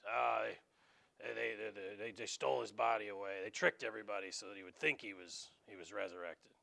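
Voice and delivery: a hint of a muttering conspiracy crank; shriveled old man insisting the body was stolen, mildly; nasty old man. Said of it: "shriveled up, nasty old man".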